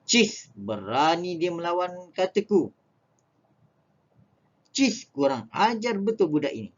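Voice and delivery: mild mock anger; angry scorn. Shown as a demonstration. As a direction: scold the cheeky child. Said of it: "perasaan marah dan juga benci".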